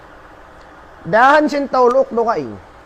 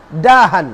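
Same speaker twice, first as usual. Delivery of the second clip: louder, falling "Dahan" for the class to catch; "Dahan" said louder with a falling tune; emphatic keyword; 3 semitones lower, 5 dB louder than usual. Shown as a demonstration. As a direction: hammer the key term home. Teacher repeats key word.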